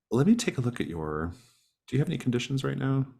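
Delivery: usual speaking voice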